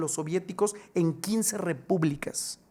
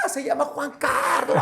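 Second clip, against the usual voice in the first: much higher, excited exclaim; excited crowd; 8 semitones higher, 3 dB louder than usual. Acted. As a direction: gasp it out thrilled. (exclamación emocionada, aguda)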